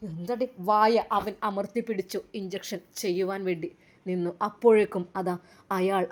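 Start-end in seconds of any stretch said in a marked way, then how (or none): none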